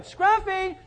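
high, drawn-out call; calling the dog. Retold or imitated. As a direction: call a lost pet down the street. Acting out calling the lost dog.

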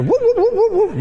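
high, laughing alarm-siren whoops; preacher mimics an alarm going off inside, high siren whoops. Sound the alarm like a siren, way up high.

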